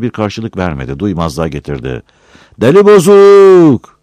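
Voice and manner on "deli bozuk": slow, much higher, very loud jeering call; drawn-out shout. Acted. Villager taunts Battal again, slow, much higher, very loud. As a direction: stretch out the taunt.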